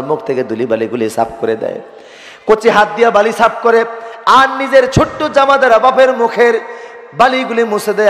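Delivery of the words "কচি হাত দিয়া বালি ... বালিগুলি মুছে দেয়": high, ringing sermon delivery; impassioned preaching. Acted. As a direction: lift the story into a high preacher's chant. (আবেগভরা উঁচু গলায় ওয়াজ)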